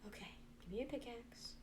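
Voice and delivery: unvoiced whisper to herself; whispering while crafting. Real, not acted. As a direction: murmur it under your breath.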